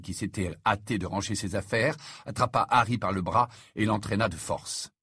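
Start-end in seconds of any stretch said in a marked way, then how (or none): none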